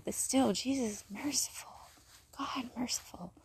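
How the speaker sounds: reverent hushed whisper. Real, not acted. Reminding viewers of God's mercy, whispered. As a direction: whisper it like a prayer.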